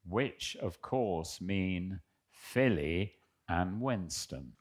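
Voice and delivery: reading a fan letter aloud, a little formal. Acted. Reading a listener's letter to the hosts, amused.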